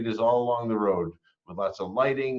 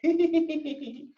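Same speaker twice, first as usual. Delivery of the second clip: giggling class. Acted.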